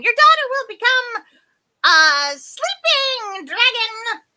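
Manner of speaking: high, very loud proclamation; half-deaf fairy. A narrator's reading. Half-deaf fairy interrupting the king, proclaiming. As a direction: proclaim it high, slowing at the end. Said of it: "Tintinnitus continued".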